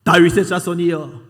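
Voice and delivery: high, loud, slow desperate plea. Retold or imitated. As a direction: shout it out to be heard over the crowd. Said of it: "더 크게 소리를 지릅니다". (더 크게 외치는 부르짖음)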